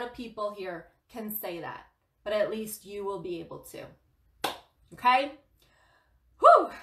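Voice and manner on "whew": high whew. Real, not acted. Exhale after the rant.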